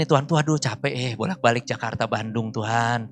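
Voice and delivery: low, weary complaint to God; mengeluh capek. Retold or imitated. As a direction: grumble softly, worn out from the commute. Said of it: "Saya mulai ngeluh". (keluhan capek dengan suara rendah)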